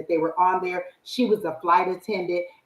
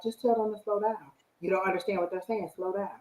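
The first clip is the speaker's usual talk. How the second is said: softer, lowered-volume advice; hushed, matter-of-fact tip; quiet coaching aside; 7 dB quieter than usual. Retold or imitated. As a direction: imitate a classmate quietly advising. Classmate's quiet advice, retold.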